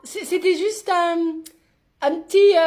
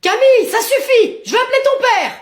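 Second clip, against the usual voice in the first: loud, higher-pitched parental threat; exasperated scolding; 5 semitones higher, 5 dB louder than usual. Acted. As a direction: lay down the law to a child. Mother scolds defiant child. (réprimande forte et exaspérée)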